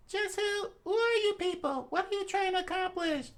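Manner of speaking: put-on high girl voice, demanding answers; Fuko demanding. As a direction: question the strangers sharply.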